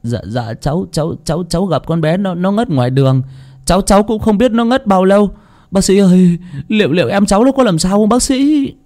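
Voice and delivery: put-on mild stammer of a frightened young man; worried nephew pleading with the doctor; trembling. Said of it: "Tùng run rẩy".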